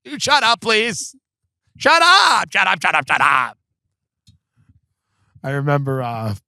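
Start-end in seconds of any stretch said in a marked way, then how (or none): 0.0-3.6 high-pitched start tumbling down in rapid repeats; mock shouting-down. Friend drowning out his mom, mock shouting-down. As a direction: talk over her in imitated exasperation, falling in pitch. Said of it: "You go".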